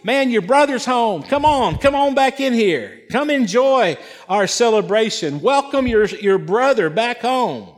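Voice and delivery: high, eager coaxing; pleading father. Acted. Father urging older son back into the party, pleading. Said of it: "begged him".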